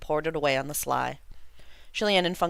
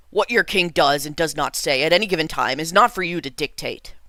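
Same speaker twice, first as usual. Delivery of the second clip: louder, stern royal rebuke; a stern king, mildly angry and louder; angrily; 7 dB louder than usual. A narrator's reading. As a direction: put the son in his place. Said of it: "He angrily replied".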